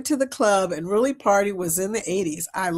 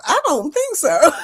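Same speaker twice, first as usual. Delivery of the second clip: laughing; 7 semitones higher, 5 dB louder than usual. Retold or imitated. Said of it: "I thought to myself".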